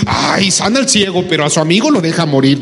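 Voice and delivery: hammed-up, sing-out indignant griping; mock troublemaker. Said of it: "un poco cizañosos". (cizañoso, quejándose con indignación fingida)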